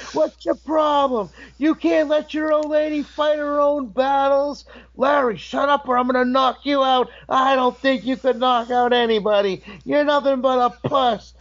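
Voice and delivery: very high, strained mock shouting; acted yelling match. Two wrestlers being pulled apart, high mock shouting. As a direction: trade threats like brawlers, breaking into laughter. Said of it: "getting louder and louder and louder".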